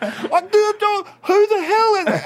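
very high, drawn-out comic outburst; irate. Retold or imitated. Actor playing her father discovers the kiss, comically irate. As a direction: explode into high-pitched mock fury. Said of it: "I'm irate".

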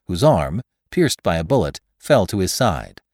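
ordinary delivery, nothing marked

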